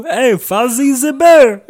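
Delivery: very high, loud Russian voice; squeaky Russian accent. Pretend. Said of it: "not even sure that was Russian".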